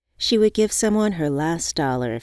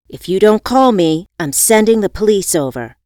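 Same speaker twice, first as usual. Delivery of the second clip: loud; 3 semitones higher, 10 dB louder than usual. A narrator's reading. Threatening text message voiced.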